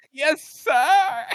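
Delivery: very high, loud mock whimper; cowed subordinate. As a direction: squeal a hammed-up submissive reply. Mock obedient reply to rank.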